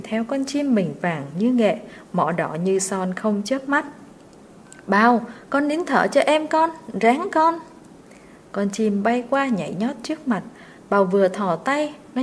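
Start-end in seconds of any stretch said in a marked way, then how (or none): 4.9-7.6 higher, eager urging; urgent coaxing call. A narrator's reading. Mistress coaxing the servant up the tree, eager and urgent. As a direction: call up and coax him on.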